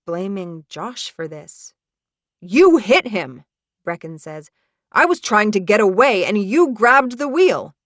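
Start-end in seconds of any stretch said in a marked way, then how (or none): 2.4-3.4 loud, slow accusation; accusing. Acted. Acted quarrel, accusing Josh. 4.9-7.7 loud, higher, heated blame; angry accusation. Acted. Acted quarrel, blaming Josh.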